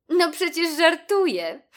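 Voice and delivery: high, loud, giggly reassurance; laughing. A narrator's reading. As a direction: say it through a giggle. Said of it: "śmiała się Mona". (ze śmiechem, wysokim głosem)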